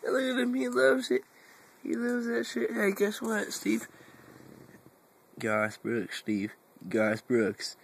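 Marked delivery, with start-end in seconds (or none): 0.0-3.9 high singsong pet voice, delighted; high doting coo; talking to the cat. Acted. Owner cooing over her cat Steve in a high pet voice.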